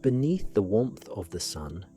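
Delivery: ordinary delivery, nothing marked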